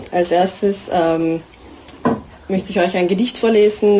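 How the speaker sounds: plain reading voice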